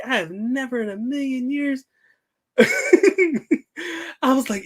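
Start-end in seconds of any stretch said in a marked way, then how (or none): 0.0-1.8 flustered. Retold or imitated. Consoling crying girl, flustered. 2.6-4.2 high laugh. Real, not acted. Laughs telling story.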